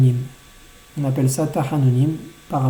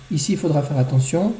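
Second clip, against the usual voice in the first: usual speaking voice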